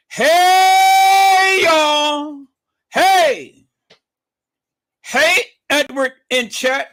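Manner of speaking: very high, long, loud whoop; hollered greeting. Retold or imitated. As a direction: holler the chat greeting with glee. Hollers a viewer's typed greeting.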